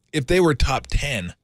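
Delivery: ordinary delivery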